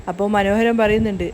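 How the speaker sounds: ordinary delivery, nothing marked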